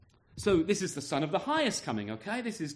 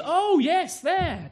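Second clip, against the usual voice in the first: higher, a bright pretend oh yes; mock recognition; 10 semitones higher, much slower than usual. Stranger pretending to recognise Great Ellingham, much higher. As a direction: play the knowing stranger, ironic. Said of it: "nobody goes".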